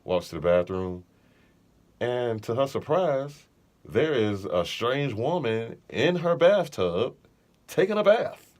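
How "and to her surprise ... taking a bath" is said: slow, building suspense, the pitch climbing toward "bath"; slow dramatic reveal. A narrator's reading. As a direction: draw out the twist like a storyteller.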